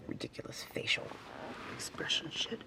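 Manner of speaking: breathy muttered whisper under her breath; whispered aside. Real, not acted. Vlogger griping about her facial tics, whispered. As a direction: grumble it to yourself in a whisper.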